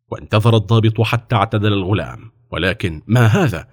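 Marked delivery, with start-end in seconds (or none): none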